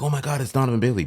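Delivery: high, loud, fast fan gush; imitating the excited crowd; starstruck crowd. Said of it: "everybody's like".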